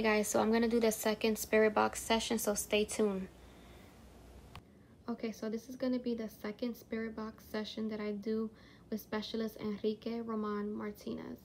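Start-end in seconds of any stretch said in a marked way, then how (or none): 5.1-11.4 hushed. Real, not acted. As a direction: speak quietly, as if not to disturb. Hushed spirit box session start.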